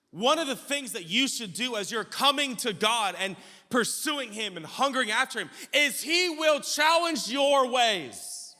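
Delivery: loud, high preacher's build; full-force preaching. Real, not acted. Preacher urging the congregation pursuing God, full-force preaching. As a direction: drive it home, stretching the last words.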